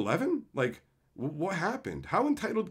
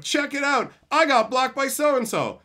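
high, loud boastful mock; mock bragging; 10 semitones higher, 6 dB louder, slower than usual. Streamer mocking Twitter users bragging about blocks, high loud. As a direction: brag like a proud Twitter user. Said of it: "this crazy sort of".

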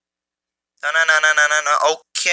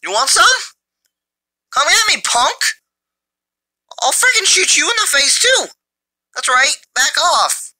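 high, hammed-up trash-talk taunts; mock tough guy; 10 semitones higher than usual. Gamer trash-talking enemy players in a hammed-up tough-guy yell. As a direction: bark threats like a cartoon tough guy.

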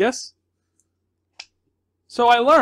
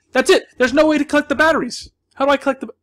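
raised, higher voice of real frustration; exasperated; 7 semitones higher, 4 dB louder than usual. Real, not acted. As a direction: burst out fed up with the game. Exasperated, cannot collect batteries.

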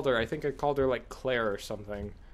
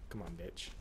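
low, quiet growl at the enemy; low muttered taunt; 8 semitones lower, 18 dB quieter than usual. Real, not acted. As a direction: mutter it under your breath.

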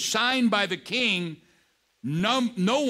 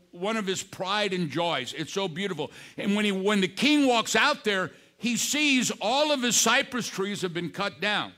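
normal talking voice

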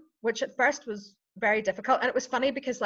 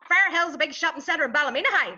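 higher-pitched Ballymena accent with plenty of twang; twangy Ballymena accent; 6 semitones higher, 4 dB louder than usual. Shown as a demonstration. Coach demonstrating twang, thick Ballymena accent. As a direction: push the Ballymena twang right up. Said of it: "that's plenty of twang in it".

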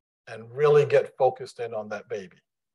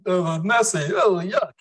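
hammed-up squeamish groan, higher; exaggerated disgust; 6 semitones higher, 3 dB louder than usual. Preacher exaggerating people's reaction, mock disgust. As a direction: overplay being grossed out. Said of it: "I exaggerate".

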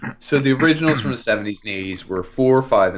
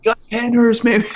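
mock excited; 13 semitones higher, 5 dB louder than usual. Silly high exclamation.

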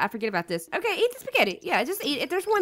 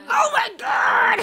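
high, laughing shriek of disbelief; exasperated laughing cry; 10 semitones higher, slower than usual. Real, not acted. Sims misbehaving, exasperated.

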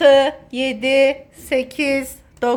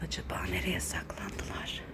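low teasing whisper while seeking; playful whisper; 20 dB quieter than usual. Pretend. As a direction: whisper as if searching quietly.

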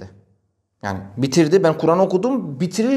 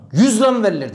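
high, loud indignant outburst; retold shout; 7 semitones higher, 4 dB louder than usual. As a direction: yell it in outrage. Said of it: "Böyle bağırdı". (yüksek sesle öfkeli bağırış)

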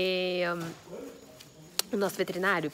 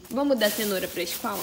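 ordinary delivery

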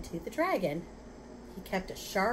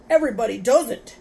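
very loud, raised angry retort; 4 semitones higher, 13 dB louder than usual. A narrator's reading. Rhinoceros rebuking the father, angry voice. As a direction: cut the intruder down loudly. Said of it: "said the angry voice".

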